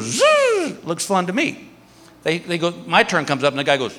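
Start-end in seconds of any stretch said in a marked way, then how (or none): 0.0-0.8 high whizzing zzz; zipline whizz. Retold or imitated. Daughter flies down the cable, high whizzing zzz. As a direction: imitate the cable zipping by. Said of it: "she goes".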